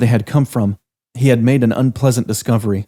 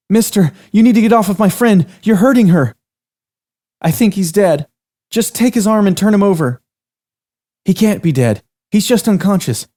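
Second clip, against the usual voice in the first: higher, louder frightened pleading; scared voices over a fallen man; panicked young women; 10 semitones higher, 4 dB louder than usual. Acted. Girls talk about the man.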